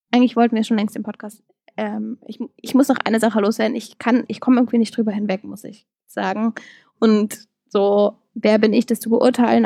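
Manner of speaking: ordinary delivery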